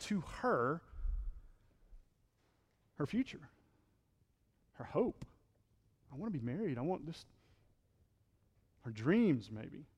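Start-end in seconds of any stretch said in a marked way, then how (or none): 6.1-7.2 soft inner wish. Retold or imitated. Voicing the woman's dreams.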